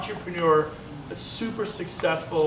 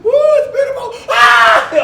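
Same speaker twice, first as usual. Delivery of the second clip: whooping yell, much higher and louder; coaster shout; 15 dB louder, slower than usual. Retold or imitated. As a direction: scream down the big drop. Roller coaster drop shout.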